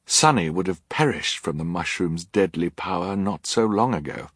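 plain reading voice

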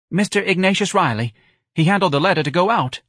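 high, loud, piping answer; timid office manager. A narrator's reading. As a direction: report it in a nervous high voice.

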